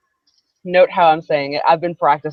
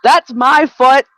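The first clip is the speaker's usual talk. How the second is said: loud, higher, drawn-out objection; startled protest; 6 semitones higher, 9 dB louder, slower than usual. Real, not acted. Speaker to the cat at her foot, startled protest. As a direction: object loudly, stretching each word.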